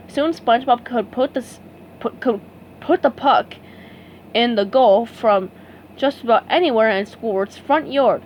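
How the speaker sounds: usual speaking voice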